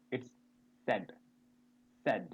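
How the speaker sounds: said with a short e, like sed; correct said. Shown as a demonstration. Teacher showing the class the short said. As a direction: model said the right way. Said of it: "It's not said".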